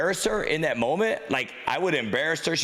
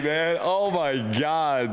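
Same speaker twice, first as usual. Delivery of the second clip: ordinary talk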